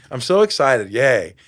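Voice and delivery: sarcastic loud fake cheer; hammed-up forced excitement; a sarcastic loud yay; mock enthusiasm. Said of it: "it's a joke at first".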